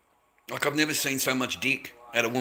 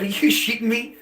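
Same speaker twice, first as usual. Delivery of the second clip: higher and louder, incredulous; stunned disbelief; 10 semitones higher, 5 dB louder than usual. Retold or imitated. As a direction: throw it out in total disbelief. Retold shock at the party.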